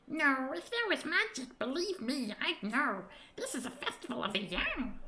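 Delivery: a little fairy explaining proudly; knowing tiny creature. Acted.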